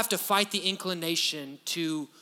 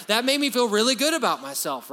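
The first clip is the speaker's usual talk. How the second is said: mock delighted; 5 semitones higher, 5 dB louder than usual. Voicing flattered listener.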